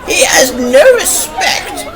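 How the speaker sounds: put-on high, slow old lady voice; indignant high-pitched lady; lady with a walking stick. A narrator's reading. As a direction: draw it out in a high, disapproving lady's voice. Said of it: "agreed a lady with a walking stick".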